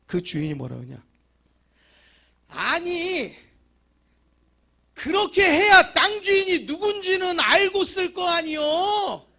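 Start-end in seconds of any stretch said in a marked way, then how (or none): 2.5-9.2 much higher, loud and slow indignant reply; loud landowner retort. Retold or imitated. Landowner scolds the complaining church, loud and high. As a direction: fling the answer back at them.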